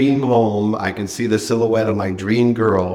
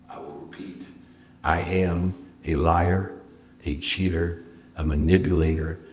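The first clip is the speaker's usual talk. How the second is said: soft, low, halting admission to oneself; hushed retelling of self-loathing, fading away at the end; somber self-confession; 4 semitones lower, 7 dB quieter than usual. Retold or imitated. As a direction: confess it quietly, each item weighed. Retold moment of self-loathing confession.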